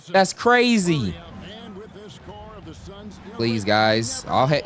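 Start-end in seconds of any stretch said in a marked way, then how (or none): 0.1-1.2 high, drawn-out exclamation; outraged disbelief. Real, not acted. Gamer reacting to the ref's call, outraged disbelief. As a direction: cry out at the call, pitched up and slow.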